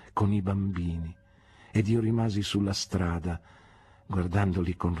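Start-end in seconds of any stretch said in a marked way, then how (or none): none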